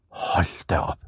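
soft, hushed amazement; awed murmur. A narrator's reading. Boy facing twenty old tyres, hushed and amazed. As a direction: let it out softly, in awe.

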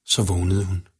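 usual speaking voice

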